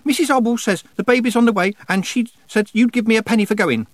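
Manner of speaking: put-on very high, loud child's voice; blurting small boy. A narrator's reading. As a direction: blurt out the message like an excited messenger boy. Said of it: "he blurted out".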